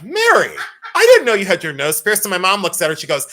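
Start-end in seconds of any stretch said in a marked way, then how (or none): 0.0-2.2 high, loud, laughing retold surprise; mock astonished doctor. Doctor to mom about the nose piercing, mock astonished. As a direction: imitate an amazed doctor, half laughing.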